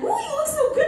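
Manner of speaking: very high, loud gushing aunt. Retold or imitated. Aunt praising her niece in her Christmas dress, gushing. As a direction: coo it up high, imitating an admiring aunt. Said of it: "my aunt being like".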